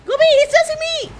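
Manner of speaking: high, loud cry for help; frantic high-pitched protest; panicked yelling. Real, not acted. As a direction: yell to a teammate while being chased. Being chased in game.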